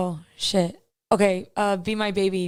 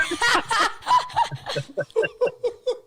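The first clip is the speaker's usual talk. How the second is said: bursting laugh; 9 semitones higher than usual. Real, not acted. Laughs after joking about voice.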